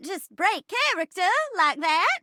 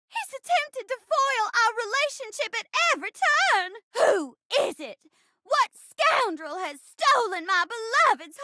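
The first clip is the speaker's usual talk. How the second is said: grand theatrical heroine, from outrage to delight; hammy romantic leading lady; melodramatic stage bride; slower than usual. Acted. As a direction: play it big, with dramatic pauses. Acts the bride in a play.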